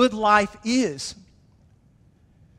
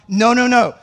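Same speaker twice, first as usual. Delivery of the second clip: louder firm denial; emphatic no; 8 dB louder than usual. Real, not acted. Preacher correcting the congregation, loud firm no. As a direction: cut it off firmly. Rejecting Thomas's apology.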